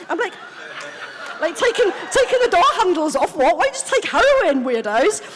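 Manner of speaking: loud, high-pitched mock outrage; exasperated, laughing disbelief; incredulous. Addict baffled by other addicts' weird stories, incredulous.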